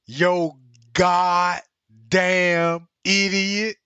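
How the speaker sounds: slow, loud, each word stretched; deliberate, emphatic mock insult; drawn-out quoted insult. Acting out a remembered quote.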